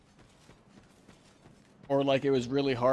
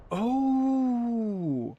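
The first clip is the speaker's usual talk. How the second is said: surprised; 9 semitones higher than usual. Real, not acted. Sudden discovery in game.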